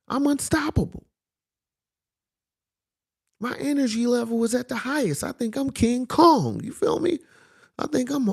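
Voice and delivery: high-pitched boasting on an energy rush; hyped-up, high-pitched self-belief; excited. Retold or imitated. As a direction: brag like you're unstoppable, voice riding way up high. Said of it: "I'm just so excited".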